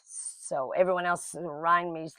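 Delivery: usual speaking voice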